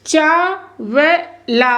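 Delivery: ordinary talk, nothing marked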